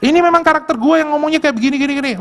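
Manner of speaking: high, fast brash mockery. Preacher mocks a rude church worker, high and fast. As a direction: sneer it out fast, mocking a brash servant. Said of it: "yang selalu ngomongnya gini".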